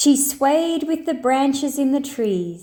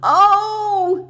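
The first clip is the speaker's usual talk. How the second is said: sobbing; 10 semitones higher, 5 dB louder than usual. A narrator's reading. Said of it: "sobbed the understudy".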